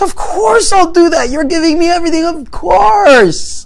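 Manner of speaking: very high, loud, overjoyed shout; hammed-up squeal of total willingness; ecstatic agreement.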